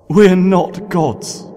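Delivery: high, loud and slow; anguished protest. Acted. Celestia protesting to Nightmare Moon, slow anguished cry. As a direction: cry out against her.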